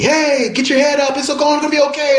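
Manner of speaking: high-pitched yell, imitating onlookers; imitated yelling. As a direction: call it out high, like people yelling encouragement. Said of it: "people were yelling at me".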